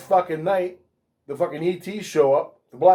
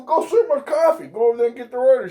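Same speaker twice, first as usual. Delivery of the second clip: higher, fast, joking command; mock bossy; 7 semitones higher, faster than usual. Teller orders coworker, mock bossy and quick. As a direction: shoo her off to work. Bossing friend in retold scene.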